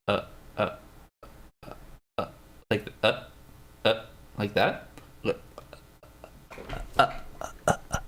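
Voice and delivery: short, soft closed "uh"s; quiet little glottal catches; soft sound demo. Shown as a demonstration. Demonstrating a closed sound, ASMR-like soft "uh". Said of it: "are we getting A S M R now".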